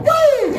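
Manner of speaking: high, loud church whoop; excited holler; joyful whoop. Real, not acted. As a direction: let it rip with joy. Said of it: "let me get that out".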